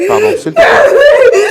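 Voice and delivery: loud, high, slow screaming sob; wailing sob. Real, not acted. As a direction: she breaks down howling. [name] hurle en pleurant. (hurlement en sanglots, aigu et lent)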